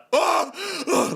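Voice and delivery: sudden high overdone gasp; mock gasp of grief.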